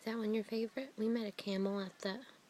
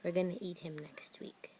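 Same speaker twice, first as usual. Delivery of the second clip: whisper; 4 semitones lower, 6 dB quieter than usual. Real, not acted. Whispered joke to toddler.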